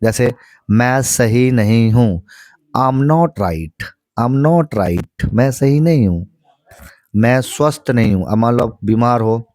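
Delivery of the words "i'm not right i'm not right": I'm not right, each word drawn out very slowly; very slow drill. Shown as a demonstration. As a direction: spell it out very slowly for learners. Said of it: "five times बोलना है". (बहुत धीरे-धीरे, शब्द खींचकर)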